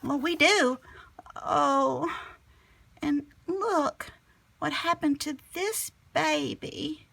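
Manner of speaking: put-on high, worried girl voice; Clementine crying out. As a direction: spot the stranded baby turtle. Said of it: "cried Clementine".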